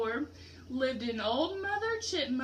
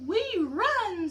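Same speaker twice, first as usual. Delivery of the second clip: higher, louder little fox voices; little foxes; 7 semitones higher, 5 dB louder than usual. Acted. Little foxes answer their mother, high and bright. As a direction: chirp it like eager fox kits.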